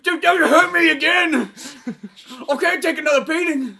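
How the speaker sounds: high, loud hammed-up begging; mock terrified victim; mock pleading. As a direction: beg loudly in a high, panicked pitch. Acted pleading with co-star bully.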